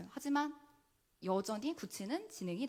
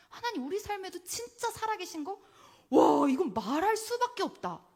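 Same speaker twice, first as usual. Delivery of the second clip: amazed testimony; 9 semitones higher, 6 dB louder than usual. Acted. Voicing believer's amazed testimony.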